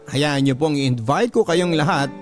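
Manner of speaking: plain reading voice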